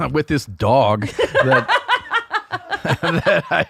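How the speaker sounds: slow words breaking into real laughter; laughing. Real, not acted. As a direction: try to explain while cracking up. Cracking up while explaining himself.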